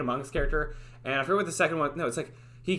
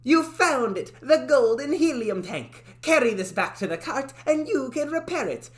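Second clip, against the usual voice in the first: high cartoon character voice; 7 semitones higher, 3 dB louder than usual. Game character congratulates the player, high and excited. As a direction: announce the find with delight. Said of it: "Talking like this".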